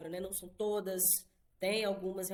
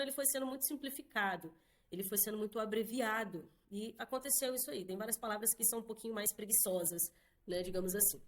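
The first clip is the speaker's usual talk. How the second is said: ordinary delivery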